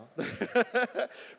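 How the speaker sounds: high laugh at own joke; amused laugh. Real, not acted. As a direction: chuckle at the pun.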